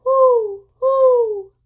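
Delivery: high, loud owl hooting. Shown as a demonstration. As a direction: hoot like an owl in the night. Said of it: "Owls hoot".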